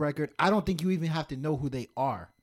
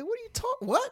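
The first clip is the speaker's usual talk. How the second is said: incredulous. Retold or imitated. Reacting to being told an accent.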